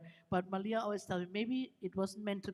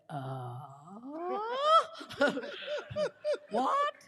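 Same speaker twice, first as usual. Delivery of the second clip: laughing cry rising to a high squeaky what; stunned; 11 semitones higher than usual. Retold or imitated. Invited to be her travel companion, high laughing disbelief.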